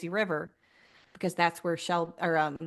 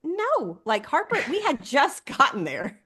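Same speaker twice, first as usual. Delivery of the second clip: higher, incredulous retort; exasperated reply; 7 semitones higher, 4 dB louder than usual. Retold or imitated. Retelling her reply to her sister, raised incredulous pitch. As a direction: raise the pitch, mock disbelief. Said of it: "I'm like".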